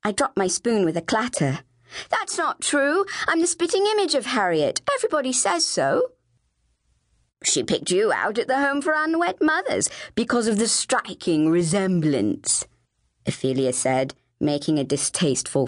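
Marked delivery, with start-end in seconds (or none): 2.1-6.1 put-on high, urgent child's protest; distressed denial. Flavia denies being adopted. 7.4-12.7 snooty Ophelia, drawing out the last word; scornful older sister. Acted. Ophelia taunts with mock explanation.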